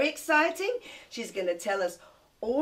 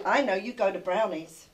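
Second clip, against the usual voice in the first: normal talking voice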